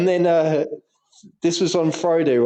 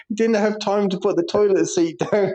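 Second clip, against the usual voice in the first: ordinary talk, nothing marked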